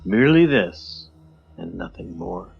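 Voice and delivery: usual speaking voice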